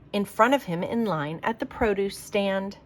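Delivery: usual speaking voice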